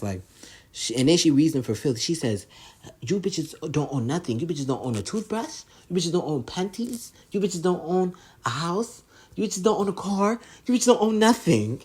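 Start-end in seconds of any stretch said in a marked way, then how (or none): 3.0-11.8 high-pitched tirade, each item lifted like a question; mock berating. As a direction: list it off scornfully, climbing higher to the last. Retelling Jocelyn berating the girls.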